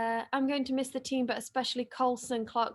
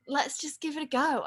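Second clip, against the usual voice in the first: ordinary talk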